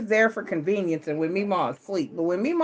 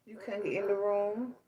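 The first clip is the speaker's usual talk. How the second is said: soft, slow comforting; tender consoling; 5 semitones higher, 6 dB quieter than usual. Real, not acted. Consoling crying grandchild at night.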